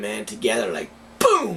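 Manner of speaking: normal talking voice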